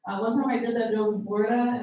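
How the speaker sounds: ordinary talk, nothing marked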